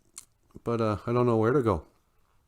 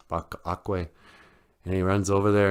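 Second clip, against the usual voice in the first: ordinary delivery, nothing marked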